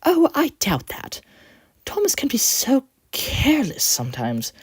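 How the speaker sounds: high, upset whimper. A narrator's reading. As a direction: whimper it, still hurt. Coach voice.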